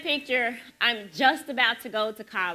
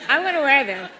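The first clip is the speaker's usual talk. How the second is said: mock Southern belle declaration; 4 semitones higher, 9 dB louder than usual. Telling her friend she'll wear stockings, with a Southern twang. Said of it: "So Southern".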